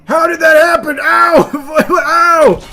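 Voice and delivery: high, loud cries of shock; shrieked disbelief and pain; pained yelling. Real, not acted. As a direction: scream out as if wounded. Fighter badly hurt in game.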